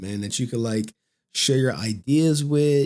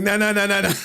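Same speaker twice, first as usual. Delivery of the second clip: mock dismissal; 7 semitones higher, faster than usual. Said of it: "like Mark gives zero fucks".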